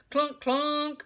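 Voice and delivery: high, loud pile-driver clunks; shouting. A narrator's reading. Child imitates pile driver, shouting. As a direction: shout it like a machine. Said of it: "shouted Ramona".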